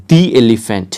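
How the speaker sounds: the elephant, "the" said "dee". Shown as a demonstration. Teacher modelling the article before a vowel, "dee elephant". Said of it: "তাহলে কি হবে?".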